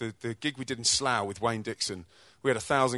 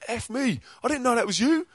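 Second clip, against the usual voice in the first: imitating a Scottish accent, pitched higher; rough Scottish accent, higher voice; Scottish kids; 12 semitones higher, 4 dB louder than usual. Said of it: "I can't do a Scottish accent".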